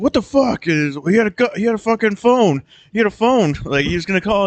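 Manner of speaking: high, frantic voice, a notch up and tense; panicked gang leader. Retold or imitated. Gang leader panicking when the killer pulls a phone, high and frantic. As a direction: squeal it out like a rattled thug. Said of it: "leader's like".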